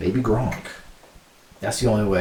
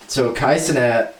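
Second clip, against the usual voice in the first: usual speaking voice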